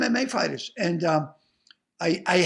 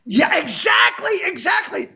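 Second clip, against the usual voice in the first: excited agreement; 9 semitones higher, 7 dB louder, slower than usual. Real, not acted. Eager agreement.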